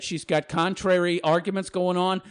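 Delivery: ordinary talk, nothing marked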